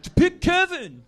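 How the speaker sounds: high, loud imitated heckle; audience heckler shout. Host imitates audience volunteering others, mock shout. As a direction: yell it out slowly like someone in the crowd. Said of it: "They go, like".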